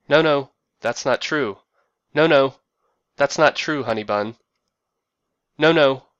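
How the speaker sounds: loud imitated protest; heated denial. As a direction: protest loudly, as in a phone row. Husband quarrelling on phone.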